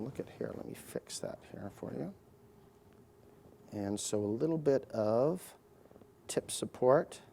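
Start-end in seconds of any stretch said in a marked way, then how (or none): 0.0-2.1 whispered aside. Real, not acted. Surgeon whispering to his patient mid-procedure, hushed. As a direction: murmur it so only she hears.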